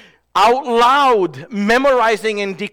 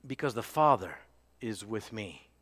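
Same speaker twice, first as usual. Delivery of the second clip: much lower and far softer, nearly breathed; hushed reverence; 11 semitones lower, 14 dB quieter than usual. Acted. Preacher softly repeats Jesus's words in hushed reverence. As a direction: let the words fall to almost nothing.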